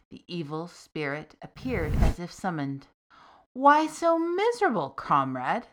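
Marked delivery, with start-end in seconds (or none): 3.5-5.7 cheerful. Acted. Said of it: "the demon asked cheerfully".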